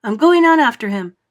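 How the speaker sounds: loud resolute call; determined cry. Acted. James going after the centipede, a loud determined cry. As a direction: call it out bravely. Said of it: "cried James".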